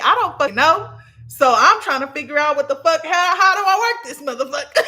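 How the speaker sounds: high, loud comic exasperation; exasperated. Retold or imitated. Dreamer retelling her struggle to work the car, exasperated. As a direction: throw up your hands in loud, high bafflement.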